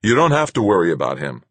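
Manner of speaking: loud, angry retort; angry growl. Acted. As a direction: growl it at her, resentful. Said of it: "I growled".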